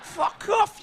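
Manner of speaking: high, loud mock outrage; shouted fuck off. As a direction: bark it at the mechanic. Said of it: "I just said".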